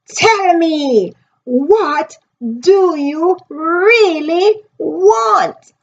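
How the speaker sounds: read-aloud urgent plea. Acted. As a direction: draw each word out, near tears. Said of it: "he said".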